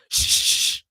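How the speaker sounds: long hushing "shh"; shushing. Retold or imitated. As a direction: imitate someone shushing a crowd. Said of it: "And then Liddy's like".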